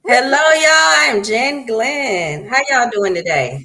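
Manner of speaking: drawn-out shouted hello; loud greeting. Real, not acted. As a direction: belt it out to the audience. Big on-air greeting.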